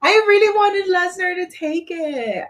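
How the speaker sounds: high, whiny wail of disappointment; disappointed. Real, not acted. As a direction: whine it like a let-down fan. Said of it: "I was disappointed".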